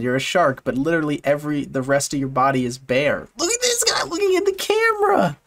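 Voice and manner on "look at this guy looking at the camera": very high squeaky coo. Real, not acted. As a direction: gush over it like a puppy. Cooing at a cute shark photo.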